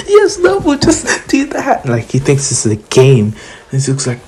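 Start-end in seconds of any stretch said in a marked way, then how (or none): 0.1-1.8 high, giggling through the words; laughing villain. Retold or imitated. Shigaraki cheering Nomu on, giggling high. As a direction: laugh while cheering the monster on. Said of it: "Shigaraki's just laughing like".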